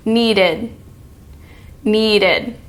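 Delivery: "needed" ending in a light "id"; "needed" with the tongue tip up behind the teeth. Shown as a demonstration. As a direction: end "needed" with an open "id". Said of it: "I'll say it and then you say it".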